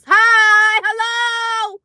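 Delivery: high, loud greeting stretched out; loud shout. Shown as a demonstration. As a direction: call out hi and hello way too loud. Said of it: "That's loud".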